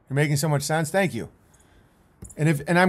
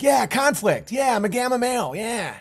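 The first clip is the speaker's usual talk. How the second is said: higher mock cheers from the crowd; mocking fans; 8 semitones higher than usual. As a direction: sneer at the fans by cheering like them. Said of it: "the people that just were like".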